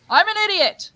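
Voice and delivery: loud, high mock shout; calling for an echo. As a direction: call it out high into the distance. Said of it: "the Echo scene".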